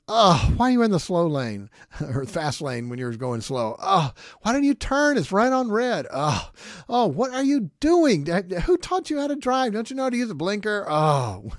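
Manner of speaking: loud, high-pitched mock road rage; exasperated driver's groaning. Preacher acting out groaning at other drivers, loud exasperation. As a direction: groan and holler at bad drivers. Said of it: "Number three, I groan, uh, driving".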